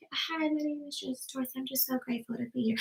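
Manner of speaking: tiny, soft, timid introduction; church mouse voice. Shown as a demonstration. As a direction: shrink into a meek little voice. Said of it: "a church mouse voice".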